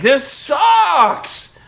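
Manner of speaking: high, loud mock shout; Cole's griping. Cole snapping about the show on air, a high hammed-up shout. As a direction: yell it like a fed-up commentator. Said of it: "He's like".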